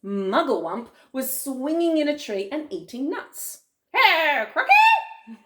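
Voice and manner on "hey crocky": very high, loud monkey call; squeaky monkey. Acted. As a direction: holler as an excitable little monkey. Monkey hails the crocodile.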